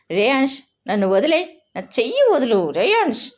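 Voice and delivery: angry and hurt yell, pulling away; shouting to be let go. A narrator's reading. Tanvi shouts at Reyansh to release her arm, angry yell. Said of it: "అతనిపై ఎన్నోసార్లు గట్టిగా అరిచాను".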